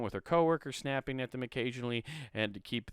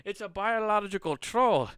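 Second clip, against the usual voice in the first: high, loud Scottish burr; excited mock-Scot announcing a monster; mock Scottish accent; 11 semitones higher, 6 dB louder than usual. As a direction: put on a hammed-up Scottish voice. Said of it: "I'll stop with the fucking Scottish accent".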